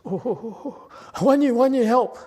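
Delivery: high, loud mock-frantic calling; hammed-up panicky dad calling Guan Yin; imitating impatient dad. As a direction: ham up an overeager cry for help. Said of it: "like my dad, who is a sooner".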